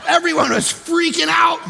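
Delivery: high and loud, swept up in it; excited shout. Real, not acted. Pastor telling the congregation, excited shout. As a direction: let the excitement burst out.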